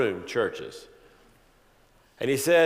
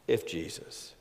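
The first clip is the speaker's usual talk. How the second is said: "If Jesus" dropped low and soft, almost to himself; hushed if; 5 semitones lower, 8 dB quieter than usual. Shown as a demonstration. Preacher musing on the question, hushed aside. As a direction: breathe "If Jesus" quietly. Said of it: "to ask if Jesus".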